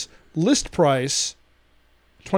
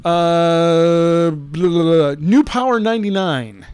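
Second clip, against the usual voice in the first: mock announcer; 5 dB louder, much slower than usual.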